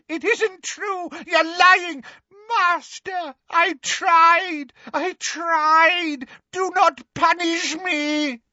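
very high, tearful pleading; screaming. A narrator's reading. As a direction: beg the master in shrieking terror. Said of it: "she screamed".

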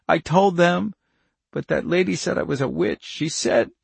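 put-on high, pleading child close to tears; tearful plea. Charlie begs the couple for help, growing tearful. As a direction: beg for help as the tears rise. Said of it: "voice dissolved into incoherent sobs".

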